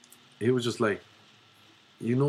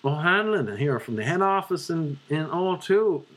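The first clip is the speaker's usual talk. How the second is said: higher-pitched Dublin head-office voice; Dublin accent; 6 semitones higher than usual. Retold or imitated. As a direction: introduce yourself in a put-on Dublin accent. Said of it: "I could do a Dublin one".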